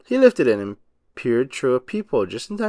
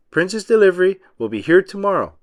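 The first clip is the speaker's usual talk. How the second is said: louder, higher repeat; shouted back; 6 semitones higher, 5 dB louder than usual. A narrator's reading. Driver shouting the message back to SpongeBob. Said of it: "the driver shouted back".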